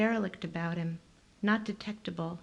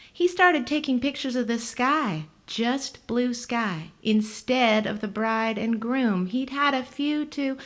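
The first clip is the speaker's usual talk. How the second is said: higher, lively woman's voice; 4 semitones higher, 5 dB louder than usual. A narrator's reading. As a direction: voice her high and bright.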